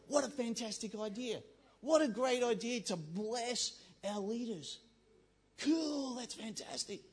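high, gushing approval; mock enthusiasm. Preacher voicing people keen to bless leaders, put-on enthusiasm. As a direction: play a delighted supporter, drawing words out.

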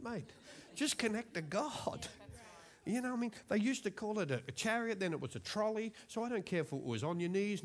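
ordinary talk, nothing marked

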